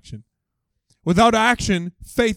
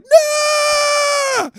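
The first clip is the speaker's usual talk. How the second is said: very high, long drawn-out shriek; mock panicked scream; 4 dB louder than usual. Kids in the boat reacting to the crocodile, hammed-up panic scream. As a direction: shriek like a terrified eight-year-old, hammed up. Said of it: "most of the people in the boat were like".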